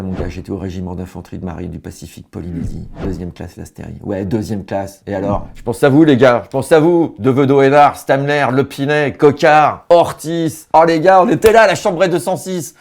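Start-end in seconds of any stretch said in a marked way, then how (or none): 5.5-12.7 put-on loud, high call to old comrades; hammed-up army nostalgia, shouted; emotional shout-out. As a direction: holler the roll call with fervour.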